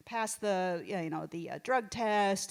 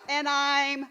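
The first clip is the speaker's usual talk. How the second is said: slow showy demo; 5 semitones higher, 6 dB louder, much slower than usual. Shown as a demonstration. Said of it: "demonstrating the mom floss".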